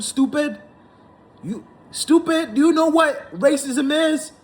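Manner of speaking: high, rising, mocking disbelief; outraged taunting question; incredulous anger. Real, not acted. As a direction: demand it like he's an idiot. Berating the pundit.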